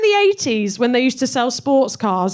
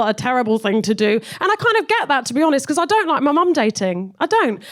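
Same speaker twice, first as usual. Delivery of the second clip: usual speaking voice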